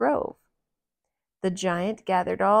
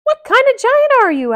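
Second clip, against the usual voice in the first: Mickey voice; 14 dB louder, faster than usual. Acted. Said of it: "asked Mickey".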